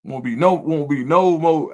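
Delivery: normal talking voice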